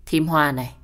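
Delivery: usual speaking voice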